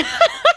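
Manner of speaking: a quick high burst of laughter; short laugh. Real, not acted. Laughing at her own coffee mix-up, a short high laugh. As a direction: laugh it off, amused at yourself.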